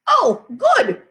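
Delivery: put-on high, loud little-kid delight; toddler voice. Fudge to his father about the omelet, high toddler voice. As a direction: play the small boy pleased with his food.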